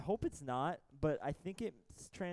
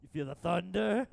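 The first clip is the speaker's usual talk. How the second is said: loud, higher movie-character voice; movie quote voice; 5 semitones higher, 8 dB louder than usual. Pretend. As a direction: belt it like a race car hero. Quoting Cars movie line.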